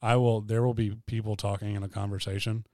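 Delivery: normal talking voice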